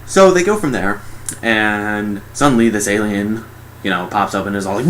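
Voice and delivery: ordinary talk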